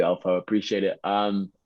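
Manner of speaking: ordinary delivery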